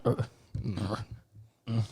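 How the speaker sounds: small mock groans of being stabbed; imitated weak grunts. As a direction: grunt feebly, imitating a wounded man. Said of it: "he was just going".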